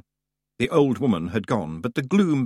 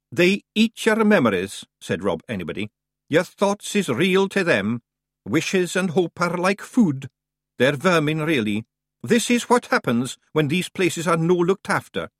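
brisk, higher Scots brogue for the wee man; Scottish Feegle voice; 6 semitones higher, 3 dB louder than usual. A narrator's reading. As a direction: play the cocky little Scot. Rob Anybody voiced in Scots.

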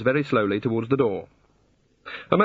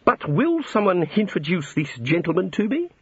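lightly sharp, higher-pitched request; a hint of pointed formality, pitched up; 7 semitones higher than usual. A narrator's reading. Housekeeper asking wizards for an introduction, mildly sharp. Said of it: "in as sharp a tone as she cared to use".

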